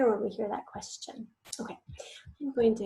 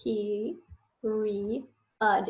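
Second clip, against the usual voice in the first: broken into slow syllables; spelling out syllables; much slower than usual. Shown as a demonstration. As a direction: say each syllable separately, with pauses.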